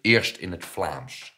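loud, drawn-out instruction; calling out. A narrator's reading. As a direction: call it out slowly and loudly. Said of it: "riep nonkel Gilbert". (luid uitroepen)

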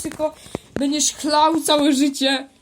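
words breaking into real laughter; can't hold back the giggles; laughing through words. Real, not acted. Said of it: "chłopie, śmiejesz?". (mówi przez śmiech)

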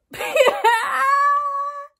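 high, loud shrieking laugh; loud squealing laugh. Real, not acted. Vlogger reacting to her own story, loud high laughing shriek. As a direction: let out a long high cackle.

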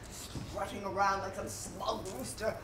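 ordinary talk

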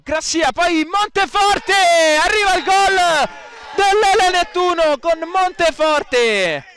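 high goal call, stretched at the end; breathless italian goal commentary. Real, not acted.

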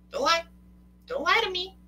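high, loud warning; mock scolding. Real, not acted. Coach challenging viewers who deny it, high teasing scold.

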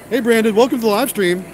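ordinary talk, nothing marked

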